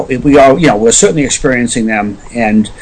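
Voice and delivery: normal talking voice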